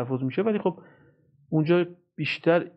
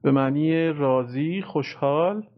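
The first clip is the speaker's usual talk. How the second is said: ordinary talk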